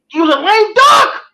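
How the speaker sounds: high, drawn-out shout; exasperated cry. Real, not acted. Fan arguing about a lame duck coach, exasperated cry. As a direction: burst out loud, stretching the words way up high.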